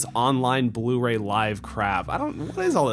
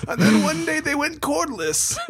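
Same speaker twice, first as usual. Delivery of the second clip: put-on old man voice. Joining the old man bit.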